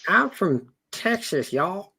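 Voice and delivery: high, slow Texan drawl on y'all; a first shaky go at a Southern accent; Southern twang, high and slow; trying a Southern accent. Acted. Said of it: "trying on this Southern accent".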